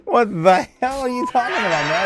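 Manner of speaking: high, loud disbelieving outburst; mock outrage. Real, not acted. Streamer calls out a chatter, mock outraged. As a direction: act scandalised at the chat. Streamer reacting to chat joke.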